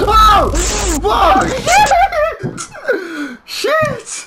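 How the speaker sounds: high shout breaking into laughs; scream into laughter. Real, not acted. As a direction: yelp in fright then laugh. Another jump scare.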